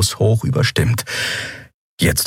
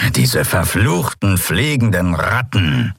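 slow, snorted curse; snorting annoyance; slower than usual. A narrator's reading. Chasu curses a fleeing pigeon, snorting in annoyance. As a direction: snort it with drawn-out disgust. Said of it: "schnaubte Chasu".

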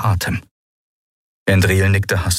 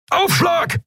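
roared warning. A narrator's reading. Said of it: "brüllte Keru".

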